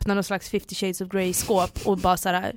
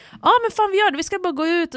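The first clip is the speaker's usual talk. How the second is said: keen agreement; 9 semitones higher than usual. Retold or imitated. Acts out keen drunken agreement.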